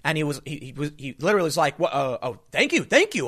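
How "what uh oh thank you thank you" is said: imitating a stunned, stammering man, pitch jumping up; shocked thanks. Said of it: "the dude was shocked".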